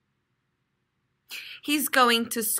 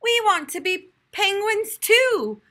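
high, louder pleading cry; eager children; 10 semitones higher, 4 dB louder than usual. A narrator's reading. Children joining in to teacher, high eager cry. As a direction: chime in eagerly together. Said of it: "cried all the children".

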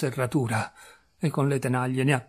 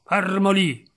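higher, firmer repeated order; raised, urgent command; sharp command; 5 semitones higher, 4 dB louder, slower than usual. Acted. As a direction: order him to hold still.

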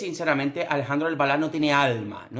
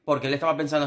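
usual speaking voice

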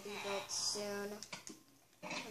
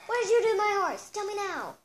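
much higher and louder, demanding; furious shout; 8 semitones higher, 10 dB louder than usual. Acted. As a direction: yell the question at the clerk. Angry guest shouting at clerk.

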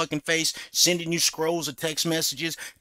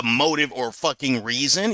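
ordinary talk

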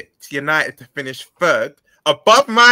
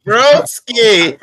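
loud, drawn-out call of Broski; loud greeting; 2 semitones higher, 7 dB louder than usual. Real, not acted. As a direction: call his name out warmly. Greeting friend loudly on arrival.

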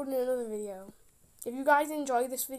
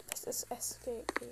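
unvoiced whisper; 2 semitones lower, 15 dB quieter than usual. Real, not acted. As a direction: breathe it out in a whisper. Reads on-screen prompt.

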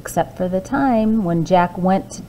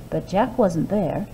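usual speaking voice

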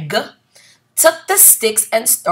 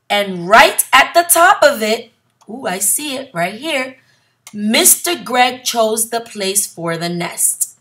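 ordinary delivery

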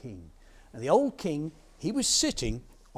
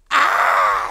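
loud storybook scream; farmer's scream; 6 semitones lower, 15 dB louder than usual. Acted. Farmer hears his dog speak, loud scream. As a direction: scream out suddenly, full volume. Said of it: "screamed the farmer".

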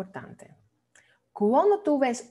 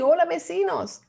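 higher acted hello breaking into a laugh; playful, laughing call-out; mock neighbourly greeting; 7 semitones higher, 3 dB louder than usual. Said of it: "No te quieres decir".

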